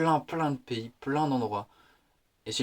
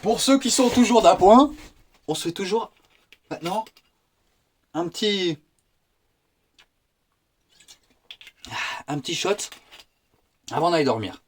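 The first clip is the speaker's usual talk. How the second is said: high, loud toast; playful announcer-style call; mock toast; 7 semitones higher, 6 dB louder than usual. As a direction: raise a glass to the faithful viewers. Toasting the viewers.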